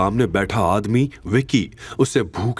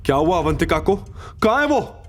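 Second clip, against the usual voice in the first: alarmed questions; 8 semitones higher than usual. Acted. Boss fears for Avantika.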